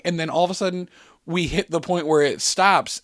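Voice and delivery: ordinary delivery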